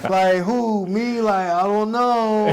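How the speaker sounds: high, loud, drawn-out disbelief; mock stunned "who, me?"; incredulous surprise. Stunned reaction retold to daughter.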